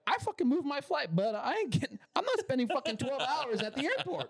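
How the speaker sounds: high, laughing mock rant; mock furious. Retelling yelling at the Uber driver, high laughing mock rage. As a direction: rant at him half laughing, pitch way up. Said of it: "I'm like".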